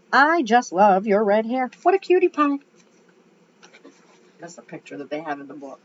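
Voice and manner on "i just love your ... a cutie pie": put-on southern accent. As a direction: gush like a doting Southern lady. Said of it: "I gave her a southern accent".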